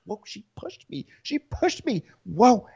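high, breathy-sounding startled cry; mock shock. Ramona acting shocked that Kenya pushed her, in a high startled voice. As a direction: act shocked and wounded. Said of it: "And I was like".

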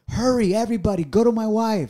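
high, panicked mock plea; rally the room in exaggerated urgency; urgent. Husband imagining the baby crowning, urgent. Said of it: "I'm thinking she's crowning already".

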